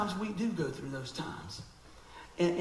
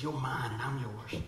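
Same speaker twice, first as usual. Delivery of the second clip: softer and lower, tender promise; God speaking tenderly; 5 semitones lower, 6 dB quieter than usual. Retold or imitated. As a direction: say it softly, like a loving father. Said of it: "And he says".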